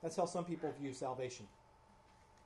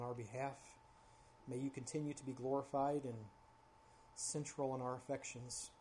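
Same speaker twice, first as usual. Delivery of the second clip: public prayer; 5 dB quieter than usual. Real, not acted. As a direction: pray quietly and earnestly, pausing between phrases. Leads the congregation in prayer.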